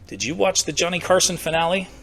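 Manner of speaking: ordinary talk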